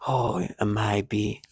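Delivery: low, soft, slow imitation; gimmicky perp voice. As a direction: stretch it out low and quiet, mimicking their gimmicky tone. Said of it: "they purposely sound gimmicky".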